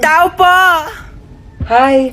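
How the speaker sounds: ordinary delivery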